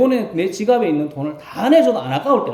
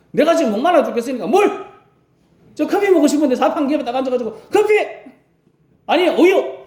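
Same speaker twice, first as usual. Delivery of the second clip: higher, pushy, quick string of demands; bossy demand; 9 semitones higher, 3 dB louder than usual. Retold or imitated. Seated elder demanding water, coffee, milk, pushy. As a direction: demand drinks as if owed them. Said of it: "이렇게 딱 이야기하는 모습이".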